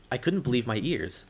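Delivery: plain reading voice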